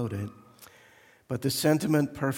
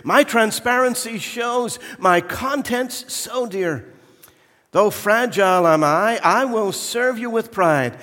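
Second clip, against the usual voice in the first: high, loud preening voice; vain crystal goblet; 7 semitones higher, 8 dB louder than usual. Acted. Crystal goblet pleads to be taken, high and preening. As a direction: show off your delicate worth. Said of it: "cried the goblet of crystal".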